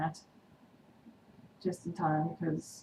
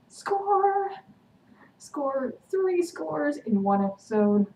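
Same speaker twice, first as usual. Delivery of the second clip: a high, loud fan whoop, then slower; cheering; 9 semitones higher, 7 dB louder, much slower than usual. Real, not acted. Fan cheering as Damon finally teaches Elena, with a high loud whoop. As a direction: shout it like your ship just sailed.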